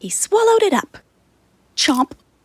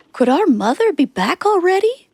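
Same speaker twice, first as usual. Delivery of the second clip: hopeful goat; 7 semitones higher, 4 dB louder than usual. A narrator's reading. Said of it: "said one of the little goats".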